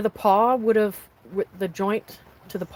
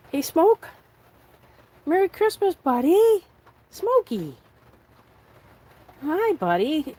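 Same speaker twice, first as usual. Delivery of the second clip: much higher and louder, cooing at the dog; sweet sing-song pet voice; 9 semitones higher, 6 dB louder, slower than usual. Real, not acted. Owner greeting her dog with Christmas wishes, high cooing baby talk.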